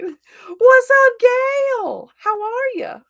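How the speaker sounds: high, loud whooped hello; excited greeting. Real, not acted. Streamer greeting Gail as she joins, excited high shout. Hails a viewer joining the stream.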